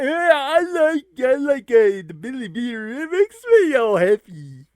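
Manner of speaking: high, loud dopey character; goofy companion voice. Acted. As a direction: play a simple, happy drinking buddy. Voicing another companion.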